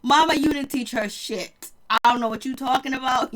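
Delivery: ordinary talk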